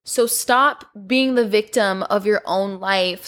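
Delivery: normal talking voice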